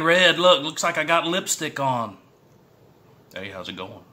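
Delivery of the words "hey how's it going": soft half-laughed hello, then a giggle; chuckling quietly at himself; laughing greeting. Real, not acted. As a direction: greet them through a laugh. Laughing at his red lips.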